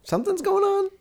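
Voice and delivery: soft high falsetto. Pretend. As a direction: float it up high. Falsetto repeat.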